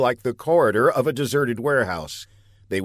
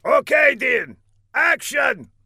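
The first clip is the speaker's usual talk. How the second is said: director's shout; 4 dB louder, slower than usual. Acted. Villain shouting to set.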